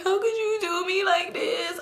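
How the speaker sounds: very high, drawn-out whiny wail; mock crying. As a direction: whine it like an exaggerated sob story. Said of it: "I'm just crying".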